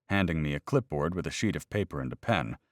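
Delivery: plain reading voice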